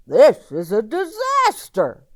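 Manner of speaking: loud, high cry of alarm; a pig wailing that all is lost; dismayed pig. A narrator's reading. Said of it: "said the pig".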